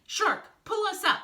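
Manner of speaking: high, loud shouted command; urgent order. A narrator's reading. Snake orders Shark to haul them up, urgent.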